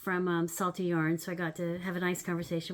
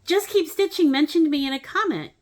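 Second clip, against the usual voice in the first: higher, louder gushing announcement; breathless excitement; 8 semitones higher, 7 dB louder than usual. Retold or imitated. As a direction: blurt out the big news. Excited reply to daughter.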